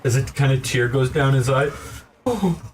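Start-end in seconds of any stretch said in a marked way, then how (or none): 2.2-2.6 a very high, bouncy insect merchant; grasshopper shopkeeper. Acted.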